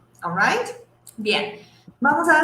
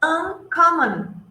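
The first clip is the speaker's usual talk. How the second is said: Uncommon, said slowly and clearly; teacher drawing out Uncommon; slow model word; 4 semitones higher, 3 dB louder, much slower than usual. Shown as a demonstration. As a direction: stretch out Uncommon for the class. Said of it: "A ver, la próxima".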